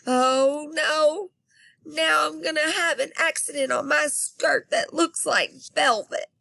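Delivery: put-on high, loud little girl in a panic; panicky kid. Girl panicking at locked doors, high and loud. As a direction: wail it like she can't hold on.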